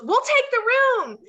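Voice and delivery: high, loud claim on the room; demanding. Retold or imitated. Punk to the clerk, loud demanding. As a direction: cut in and grab the room. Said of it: "Punk goes".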